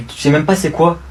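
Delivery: ordinary talk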